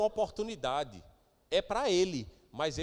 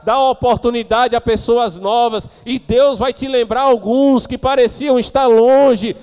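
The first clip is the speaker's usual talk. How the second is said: pregação inflamada; 6 semitones higher, 17 dB louder than usual. Real, not acted. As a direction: exhort the congregation at full voice. Pregador exorta com força. (pregação em voz alta e inflamada)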